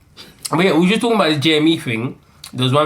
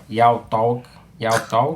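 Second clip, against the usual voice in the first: Yao Tog said in a put-on West African accent, lower pitch; 3 semitones lower, much slower than usual. Shown as a demonstration. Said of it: "You have to say it with a Ghanaian accent".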